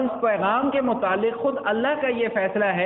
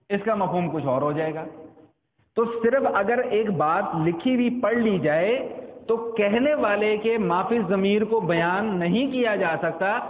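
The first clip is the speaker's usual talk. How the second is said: usual speaking voice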